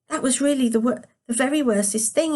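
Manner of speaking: put-on a small boy asking, a bit disappointed; disappointed young grandson. A narrator's reading. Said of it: "He sounded a little disappointed".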